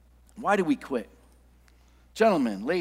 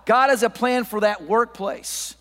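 raised, insistent preacher delivery; impassioned preaching; 4 semitones higher, 4 dB louder than usual. Real, not acted. Preacher urging the congregation that God has a plan, impassioned. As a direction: drive each phrase home to the congregation.

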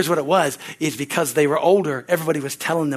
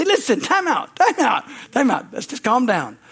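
high, raised voice calling everyone to stop; calling time out; 7 semitones higher than usual. Retold or imitated. Calming panicked siblings.

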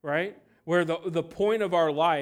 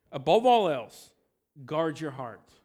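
emphatic preacher's call; loud proclamation; 7 dB louder than usual. Acted. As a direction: ring out Above all else, then guard your heart. Preacher proclaims the key verse.

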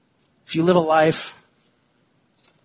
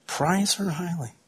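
"Prize her highly" said softer and slow, almost to oneself; tender softer echo of "Prize her highly"; hushed repeat; 2 semitones higher, 8 dB quieter, slower than usual. Shown as a demonstration. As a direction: let "Prize her highly" fall to a near murmur. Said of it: "repeated softer".

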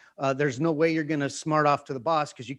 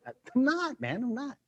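much higher, insistent "I'm not"; defensive denial; 9 semitones higher, 4 dB quieter than usual. Retold or imitated. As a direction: protest it's nothing, higher and tight.

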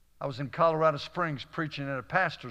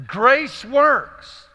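loud, high proclamation; emphatic preaching; 10 semitones higher, 11 dB louder than usual. Real, not acted. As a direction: proclaim it at full voice to the congregation. Climactic repeat of sermon theme.

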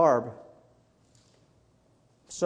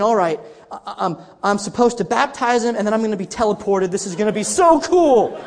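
giddy, building to a loud finish; excited inner thought; 4 dB louder than usual. Retold or imitated. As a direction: act out your own gleeful anticipation, bursting at the end. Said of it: "I'm thinking".

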